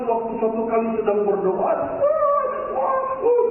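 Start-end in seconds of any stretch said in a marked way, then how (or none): 1.7-3.4 very high mock wail; high-pitched imitation of someone shrieking; imitated screaming. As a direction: mimic the screaming without shouting. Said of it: "dia teriak-teriak begitu".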